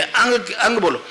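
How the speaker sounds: ordinary delivery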